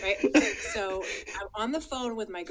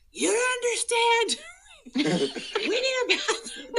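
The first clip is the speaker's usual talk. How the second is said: high, loud, slow pleading through laughter; desperate plea; 11 dB louder than usual. Retold or imitated. Begging husband on walkie-talkie, high desperate plea. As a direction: beg like it's an emergency.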